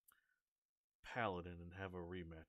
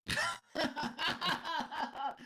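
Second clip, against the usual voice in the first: sudden high cackle of a laugh; loud burst of laughter; 12 dB louder than usual. Real, not acted. Table laughs at the question.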